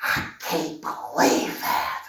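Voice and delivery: low, slow whisper of disbelief. Real, not acted. Preacher whispering disbelief at Sarah's choice. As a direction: breathe it out slowly, barely voiced.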